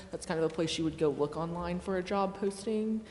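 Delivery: usual speaking voice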